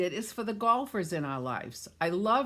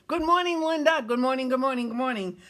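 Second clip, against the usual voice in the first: much higher, louder, delighted hello to Paul; excited greeting; 6 semitones higher, 5 dB louder, faster than usual. Real, not acted. Host greeting her nephew joining the stream, high and loud. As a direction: call out a thrilled welcome.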